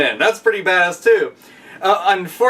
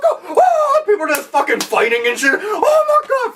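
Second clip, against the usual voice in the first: much higher, laughing and squealing; mock fan hysteria, much higher and laughing; crowd going wild.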